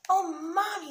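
put-on high, loud little-boy delight; happy child. A narrator's reading. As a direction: gush it like a pleased kid. Happy child thanking mother in story.

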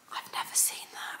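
whispered disbelief. Real, not acted. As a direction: breathe it out in a whisper. Muttered disbelief at buttons.

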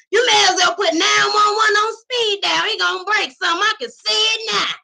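high, loud mocking shout; hollering old man. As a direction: jeer loudly from the side. Another man mocking loudly.

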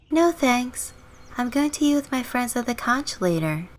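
put-on high, girlish neighbor voice; friendly neighbor girl. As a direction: pitch it up as the chatty girl next door.

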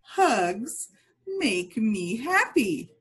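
slow, clear, cheerful model; slow model phrase. Shown as a demonstration. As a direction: say it slowly for kids to copy.

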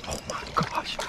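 breathless hushed gasp of delight; awed whisper over the catch; excited whisper. Real, not acted. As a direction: whisper it, thrilled by the fish.

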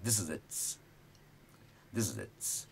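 "this is its" run together in one go. Shown as a demonstration. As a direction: join the words up quickly, no gaps. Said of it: "थोड़ा fast में".

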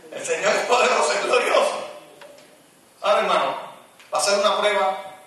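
high, loud shouted praise; fiery preaching. Acted. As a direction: lift the congregation with full-voiced preaching. Preaching at full force.

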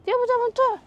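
sing-song call to a dog; high coaxing call. Real, not acted. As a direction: coax the dog, high and bright. Calling the dog again.

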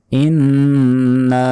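ইন্না with the final না held one alif long; drawn-out vowel. Shown as a demonstration. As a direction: hold the না in ইন্না, doubled ন. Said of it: "এক আলিফ টান হবে নাতে".

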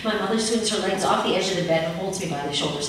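plain reading voice

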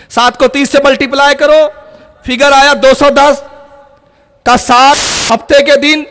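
loud, high, emphatic preaching; preaching at full force. Acted. Preacher driving the calculation home to the congregation, loud. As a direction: hammer each figure home to the crowd.

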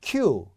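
Q said long and firm; isolated, drawn-out Q; strong stressed syllable. Shown as a demonstration. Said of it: "Q가 강한 겁니다".

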